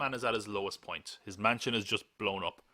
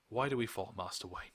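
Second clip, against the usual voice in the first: Alfred; 5 dB quieter than usual. Retold or imitated. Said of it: "And Alfred says".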